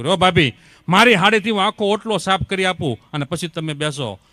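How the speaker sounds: loud, eager, respectful offer from the younger sister-in-law. Retold or imitated. (ઉત્સાહથી મોટેથી આદરભર્યું કહેવું)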